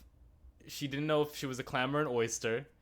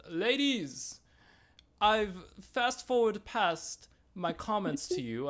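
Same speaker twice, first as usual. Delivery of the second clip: put-on high, drawn-out British-accented announcement; grand host impression slipping into a British accent; 8 semitones higher, 3 dB louder, slower than usual. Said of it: "my [name]'s British all of a sudden".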